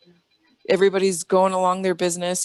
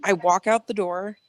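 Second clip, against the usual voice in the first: ordinary delivery, nothing marked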